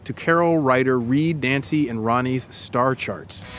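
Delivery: lightly voiced guided-scene narration, calm and even; story narration. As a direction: paint the scene for her, unhurried. Narrates immersive scene to co-host.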